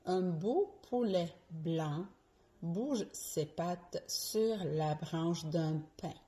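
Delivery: tongue twister said slowly, every B and P crisp; "Un beau poulet blanc" with popped P and voiced B, slow; virelangue lente. Shown as a demonstration. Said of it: "Je répète pour la dernière fois". (virelangue articulée lentement, B et P bien distincts)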